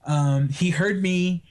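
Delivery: ordinary talk, nothing marked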